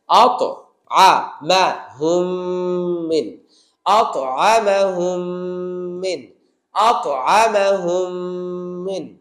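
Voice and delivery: syllables said one by one with a bounce on আত; syllable drill. Shown as a demonstration. Teacher drilling syllables for students, slow and separate. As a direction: split it into syllables, bouncing the qalqala. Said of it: "কলকলা করতে হবে এখানে".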